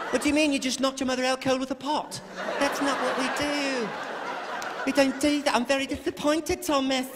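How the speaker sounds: a touch of an unflappable English nanny; calm English nanny. Retold or imitated. Super Nanny calmly scolds naughty Thomas, lightly voiced. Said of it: "she never loses her cool".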